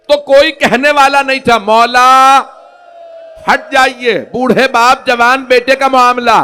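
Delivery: loud, high, wailing majlis recitation; full-force preaching. Acted. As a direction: cry it out to the mourners. (ऊँची आवाज़ में दर्दभरी मजलिस)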